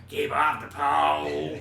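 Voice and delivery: slow loud shouted line; Chris Rock impression. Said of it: "when people do Chris Rock".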